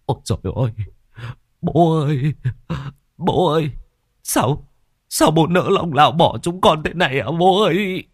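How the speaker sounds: high, grief-stricken cries to a dead father; keen out the grief, voice pitched high and pleading; wailing lament. Acted. Sons wailing over their father's hanging body, high tearful lament. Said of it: "Tiếng khóc than ai oán vang vọng".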